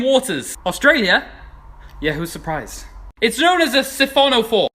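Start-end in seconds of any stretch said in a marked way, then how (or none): 2.0-2.9 lower, softer sarcastic mumble; deadpan aside. Acted. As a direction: throw it away dryly. Deadpan aside about Australia.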